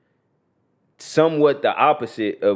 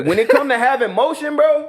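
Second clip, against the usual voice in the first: excited exclamation; 3 dB louder than usual. Real, not acted. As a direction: pitch shooting way up on bro. Hyping up T and them.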